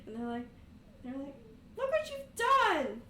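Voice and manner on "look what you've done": high, loud, slow accusation; high, slow, loud scream of blame; outraged yell. Acted. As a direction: shout it in outrage. Bullies yell after blast.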